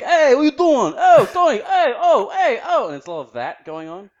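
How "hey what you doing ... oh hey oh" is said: loud, high-pitched Brooklyn heckling; Brooklyn guy. Acted. Australian mocking Brooklyn locals, loud caricature Brooklyn accent. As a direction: holler it like a cartoon New Yorker from Brooklyn. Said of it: "these guys in Brooklyn who are like".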